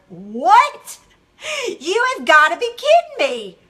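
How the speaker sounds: loud, high disbelief; indignant re-enacted outburst; acted outrage. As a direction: explode at shocking news, hammed up. Acting out outrage at a message.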